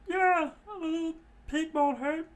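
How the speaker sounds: stammer, caught off guard; flustered high voices. Acted. Johnny and Yosaku flustered by Nami, high. Brothers eager to peek.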